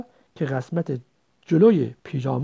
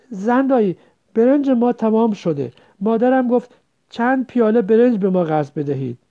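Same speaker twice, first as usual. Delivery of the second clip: put-on high, loud voice of a pleading girl; child's plea; 6 semitones higher, 7 dB louder than usual. Girl asking headman's wife to lend rice, pleading. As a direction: ask loudly for a favour.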